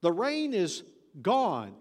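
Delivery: "gone" loud and drawn out; stress on GONE. Shown as a demonstration. Preacher declaring the rain finished, stressing "gone". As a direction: land slowly and hard on "gone". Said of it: "Emphasis on the word gone".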